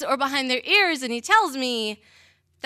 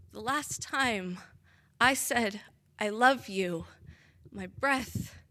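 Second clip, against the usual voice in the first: slow, soft, lowered voice with long pauses; hushed sorrow; 3 semitones lower, 5 dB quieter, slower than usual. Acted. Dying narrator recalls the last I love you, hushed sorrow. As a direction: let each phrase fall away softly.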